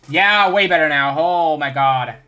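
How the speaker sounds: loud happy approval; loud excited. Real, not acted. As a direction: call it out happily. Excited reaction.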